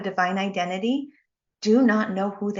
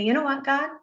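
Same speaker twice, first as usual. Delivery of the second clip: frustrated prayer; 5 semitones higher than usual. Retold or imitated. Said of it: "I say".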